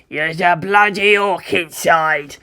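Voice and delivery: slow, emphatic ork brute voice; blustering creature voice; war boss. Acted. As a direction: roar it slowly like an ork warlord. War boss answers the boy.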